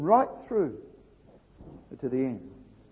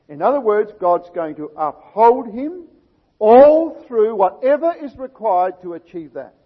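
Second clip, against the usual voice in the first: preaching at full force; 3 semitones higher, 14 dB louder than usual. Acted. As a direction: drive the promise home. Preacher proclaims God's promise.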